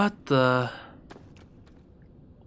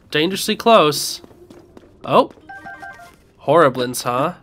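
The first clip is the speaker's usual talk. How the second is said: ordinary delivery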